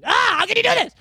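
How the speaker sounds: high, loud anguished yell. Retold or imitated. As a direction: yell it in disbelief. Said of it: "or yelling".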